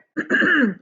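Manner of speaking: real cough to clear the throat. Real, not acted. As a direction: clear your throat roughly before going on. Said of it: "I'm gonna cough for a second".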